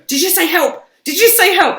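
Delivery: high, loud repeated questioning; excited. Retold or imitated. Questioning everyone in the room, high and urgent.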